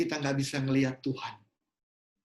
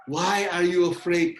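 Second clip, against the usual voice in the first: voice of the Lord; 6 dB louder, slower than usual. Retold or imitated. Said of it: "kata Tuhan".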